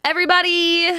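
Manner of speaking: high, sung-out call for attention. Real, not acted. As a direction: sing it out high to gather everyone. Host calls the room to order.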